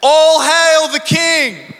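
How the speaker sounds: high, loud, slow royal proclamation; herald's proclamation. Retold or imitated. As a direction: announce it like a herald to the crowd. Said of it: "And they say".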